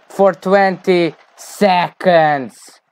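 For twenty seconds dragged out slow and loud; slow emphatic repeat. Shown as a demonstration. Coach telling his players exactly how long to argue, slow and emphatic. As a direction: spell out each word slowly, firmly.

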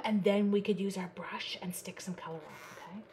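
soft unvoiced whisper. Real, not acted. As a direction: whisper it as an aside. Whispered aside.

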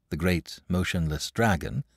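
plain reading voice

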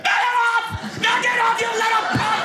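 extremely high, hammed-up scream; snapped, mock fury. Moviegoer to seat-kicking kid, high mock-furious scream. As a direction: shriek it in comic rage. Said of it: "But I snapped".

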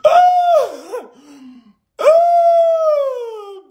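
long, high, loud "oh" wail; loud sustained hoot after a roast; hyped-up hoot. Real, not acted. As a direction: let out a drawn-out, high-pitched whoop. Hooting after finishing the roast.

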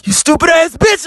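sudden furious yell; angry shout. Real, not acted. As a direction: let it rip at her. Outburst at his ex.

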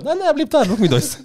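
high-pitched imitated objection; hammed-up high protest; mock protest. Uncle overrules father in acted quarrel.

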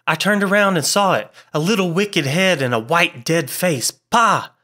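high-pitched horror, bursting at the end; horrified. A narrator's reading. Frightened man describing the face he saw, horrified. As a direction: recount it in fright, building to revulsion.

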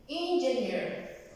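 "engineer" said slowly and clearly; slow English demo. Shown as a demonstration. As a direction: model "engineer" slowly for the class. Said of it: "Ingeniero en inglés se dice".

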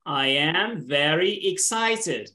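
slow and deliberate, word by word; slow model phrase. Shown as a demonstration. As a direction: space the words out for a learner to repeat. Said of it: "Repeat the word expression".

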